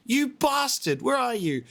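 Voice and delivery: high, indignant yelling at him; a touch of mock rage; mock furious call-out. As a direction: call out after the one who left. Scolding the departed elf.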